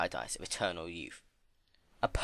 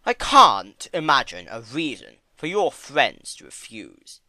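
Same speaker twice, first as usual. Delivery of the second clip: slow, much louder, smooth persuasion; Discord; 3 semitones higher, 9 dB louder than usual. Acted. Discord coaxing Fluttershy, slow, loud and smooth. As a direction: lay it on thick.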